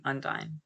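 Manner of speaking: "Undyne" with the eye vowel of diner. Shown as a demonstration. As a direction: say "Undyne" as un-DINE. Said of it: "Like a diner".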